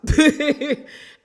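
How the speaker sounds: loud high laugh; incredulous cackle; burst of laughter. Real, not acted. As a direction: crack up out loud. Laughs at the cast's denial.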